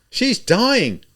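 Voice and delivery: high, loud cry. A narrator's reading. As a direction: cry out from the crowd. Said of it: "someone cried".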